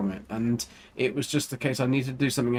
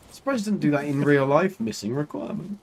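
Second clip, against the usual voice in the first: usual speaking voice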